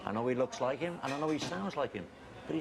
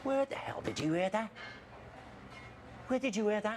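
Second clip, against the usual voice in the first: higher, sharp and rattled; alarmed anger; 8 semitones higher than usual. Acted. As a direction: caught out, demand to know. Confronted about buying all property.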